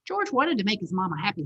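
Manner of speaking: plain reading voice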